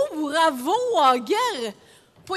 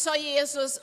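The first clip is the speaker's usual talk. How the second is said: usual speaking voice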